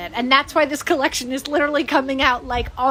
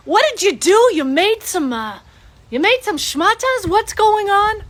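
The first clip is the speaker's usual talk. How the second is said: higher, louder incredulous dad; imitating dad; 7 semitones higher, 5 dB louder than usual. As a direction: play a baffled, nagging father. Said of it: "my dad is like".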